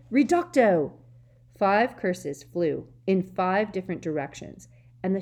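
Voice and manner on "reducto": loud high bellow. A narrator's reading. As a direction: bellow the spell. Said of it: "Five different voices behind him bellowed".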